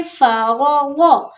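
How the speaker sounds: "फरागो" slow, with a thick "गो"; heavy final letter. Shown as a demonstration. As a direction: round out the last letter of "फरागो". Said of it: "गौद को मोटा पढ़ना है".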